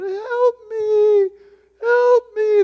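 high, crying plea of someone lost; high, far-off tearful cry; desperate cry for help. Retold or imitated. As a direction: wail it out like a lost person in the dark. Lost person in a movie crying out.

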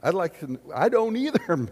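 usual speaking voice

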